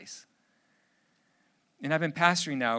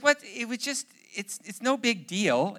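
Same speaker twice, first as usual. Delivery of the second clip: higher, feigned innocence; innocent teen; 6 semitones higher than usual. Retold or imitated. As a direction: shrug it off like a son. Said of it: "And he goes".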